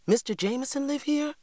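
put-on high, eager child's question; eager cry. A narrator's reading. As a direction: call out in sudden delight. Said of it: "I cried".

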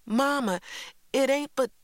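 put-on high, loud child's objection; protesting. A narrator's reading. Child argues with Mama over going to bed, protesting. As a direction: push back in a high voice, about to be cut off.

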